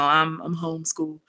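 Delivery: sheepish mumble. Retold or imitated. Shy boy asked what school he goes to, sheepish. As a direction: imitate an embarrassed kid answering under their breath. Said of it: "would always drop his head".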